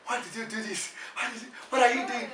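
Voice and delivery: high-pitched imitation of overwhelmed fiancée; mock tearful questioning; tearful protest. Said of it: "And she was in tears".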